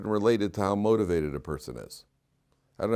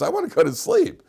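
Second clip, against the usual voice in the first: much higher and louder, pleading to go to bed; whiny plea; 7 dB louder than usual. Retold or imitated. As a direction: whine it like a worn-out child begging. Wanting to trade places with the kid.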